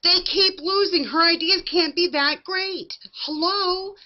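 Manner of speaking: high, loud, exasperated yelling; re-enacted screaming. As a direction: scream it at the TV in disbelief. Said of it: "I'm screaming".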